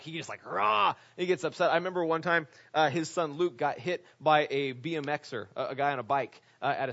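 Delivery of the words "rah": imitated Hulk-like roar. Preacher imitating his protective pastor losing it, mock roar. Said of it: "he's like the Incredible Hulk".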